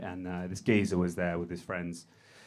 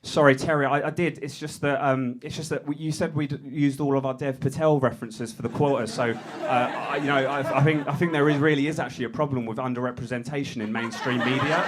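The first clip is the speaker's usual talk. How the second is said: higher stammering apology; flustered junior; 4 semitones higher, 5 dB louder than usual. Pretend. Clive apologizing to Terry, higher and flustered. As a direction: stumble through an excuse.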